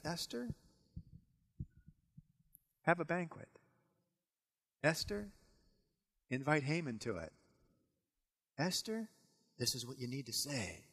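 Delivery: soft, hushed guidance calling her name; God quietly directing Esther; God softly instructing Esther what to do; gentle voice of God. Acted.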